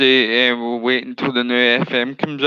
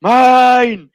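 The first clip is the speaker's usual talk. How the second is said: loud, high drawn-out call; full-voiced re-enacted footballer's yell; retold shout; 8 semitones higher, 8 dB louder than usual. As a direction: shout it across the pitch, claiming the ball. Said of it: "so I shout".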